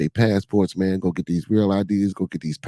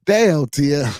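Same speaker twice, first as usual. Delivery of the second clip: higher, louder with a laugh; laughing damn; 4 semitones higher, 5 dB louder, slower than usual. Real, not acted. Streamer reacts to chat, laughing. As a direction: laugh out the word. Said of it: "Five years in the pen helped me a lot".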